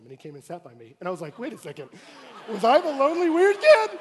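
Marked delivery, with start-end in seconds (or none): 1.4-4.0 rising, loud, laughing disbelief; laughing realization. Real, not acted. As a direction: laugh as the penny drops. Realizing he was the lonely kid.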